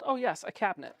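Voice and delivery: ordinary delivery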